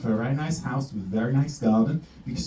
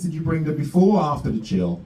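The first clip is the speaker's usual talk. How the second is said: usual speaking voice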